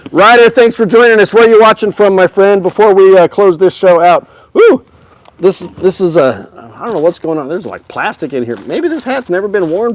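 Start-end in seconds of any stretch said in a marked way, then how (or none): 4.5-4.8 excited whoop. Real, not acted. Cheering before show close.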